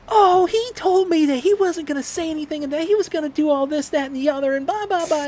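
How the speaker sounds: very high, loud squeaky wife impression; the wife caught out, voiced as a high squeaky caricature; mock wife voice.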